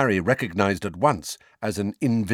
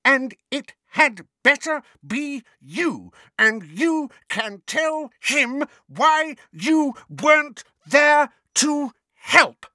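high, slow, hammered-out scolding; furious yell; 5 dB louder, slower than usual. A narrator's reading. Neighbour swinging cat food at Mundungus, yelling. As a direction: yell it word by word between swings. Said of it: "yelled Missus Figg".

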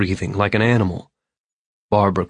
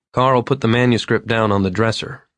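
ordinary delivery, nothing marked

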